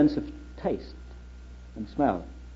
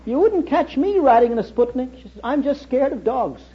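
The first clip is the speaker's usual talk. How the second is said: put-on higher old Australian lady, slowing at the end; piping old lady; 8 semitones higher than usual. Old lady on the streetcar shrugging off Sputnik, higher and piping. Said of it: "she piped up".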